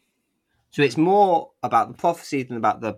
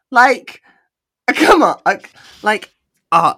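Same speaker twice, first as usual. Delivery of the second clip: loud, high, laughing frustration; exasperated outburst; 10 semitones higher, 11 dB louder, slower than usual. Real, not acted. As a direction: cry out in amused disbelief. Exasperated outburst about Apollo.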